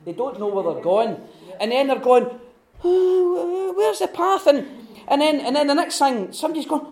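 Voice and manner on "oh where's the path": high, bewildered hobbit asking for the path; lost hobbit. Retold or imitated. Dazed hobbit wandering off the path, high and lost. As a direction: play a confused little hobbit, much higher.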